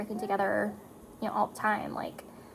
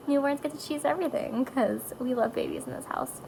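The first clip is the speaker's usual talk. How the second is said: normal talking voice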